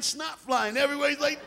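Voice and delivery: normal talking voice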